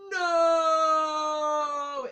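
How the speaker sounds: anguished shouted "No!" like Vader; hammed-up Darth Vader cry of no. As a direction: bellow it out in despair, Vader style. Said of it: "Insert Darth Vader".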